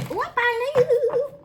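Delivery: long high sing-song whoop; playful whoop. Real, not acted. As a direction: stretch it out high and loud. Playful exclamation drawing a card.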